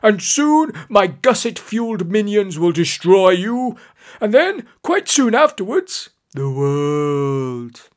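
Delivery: loud, theatrical villain's threat; menacing villain. A narrator's reading. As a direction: build high, then drop on the last words. Villain threatens world domination in story.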